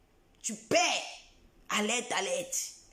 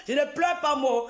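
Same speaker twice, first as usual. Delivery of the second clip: ordinary talk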